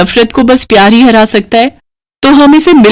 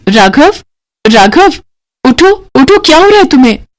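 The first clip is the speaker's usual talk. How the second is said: calling out; 7 semitones higher than usual. Acted. Shaking a sleeper awake.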